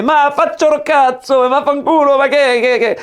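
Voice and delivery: exaggerated Italian accent, scolding; mock Italian accent. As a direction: play the Italian waiter telling you off. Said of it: "c'est un espèce de rital".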